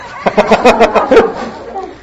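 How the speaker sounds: a sudden high burst of laughter. Real, not acted. Preacher laughs at his own aside, high and bright. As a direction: laugh out loud, high and free.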